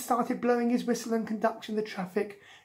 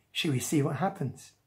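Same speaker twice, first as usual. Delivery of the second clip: usual speaking voice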